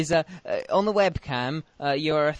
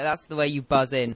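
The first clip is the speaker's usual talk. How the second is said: usual speaking voice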